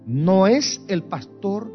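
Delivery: ordinary talk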